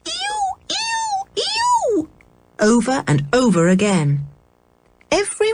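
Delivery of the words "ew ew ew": very high, thin squealing calls; gull-like cries. A narrator's reading. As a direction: squeal like seabirds overhead.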